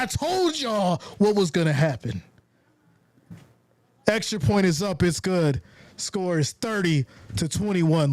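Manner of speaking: ordinary talk, nothing marked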